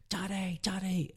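put-on high, frightened little girl's call; panicked child crying Daddy. Imitating his scared daughter calling out to him, high and panicky. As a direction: imitate a scared toddler calling for her dad. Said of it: "really freaking out".

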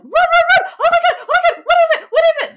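high frantic screams, laughing; frantic screaming. Retold or imitated. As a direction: scream at the screen in panic. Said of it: "what I was screaming at my screen".

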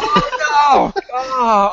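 high, loud thrilled oh no; excited squeal. Real, not acted. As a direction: yelp with delight at the idea. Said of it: "that just got me all excited".

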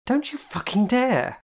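high, slow, alarmed threat; alarmed warning. Acted. Jane warns Kitty not to splash her, high and alarmed. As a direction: shriek a warning, pitch up.

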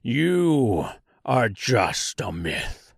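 slow, drawn-out monster voice; screaming tree creature. A narrator's reading. Giant tree to the Tin Woodman, slow monstrous protest. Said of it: "it screamed".

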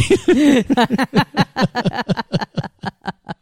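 long, high, fading laughter. Real, not acted.

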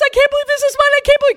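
very high, loud squealing joy; screaming in disbelief. Retold or imitated. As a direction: squeal it like an overjoyed teen. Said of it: "screaming, calling me".

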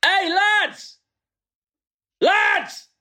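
high football terrace yelling; soccer hooligan. Acted. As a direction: shout like a lad on the terraces. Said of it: "I'm just gonna shout all of this".

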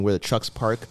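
usual speaking voice

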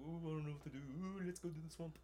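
high, airy mock kid voice; lightly mocking kids dithering by a swamp; silly British kids.

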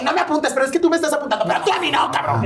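shrill her tipsy self back at him, half laughing; high drunk protest. Retold or imitated. [name] quoting her drunk self arguing, high mock protest. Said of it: "Y le decía".

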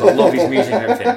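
quick, high, laughing through a retold line; laughing quote. Retelling Boozer's dismay to friends, fast laughing. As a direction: break into laughter mid-quote.

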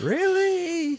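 squeaky high "really?"; high incredulous. Acted. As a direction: jump way up in disbelief. Mock disbelief at Blues chance.

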